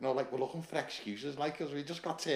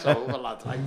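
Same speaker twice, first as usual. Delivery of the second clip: ordinary talk, nothing marked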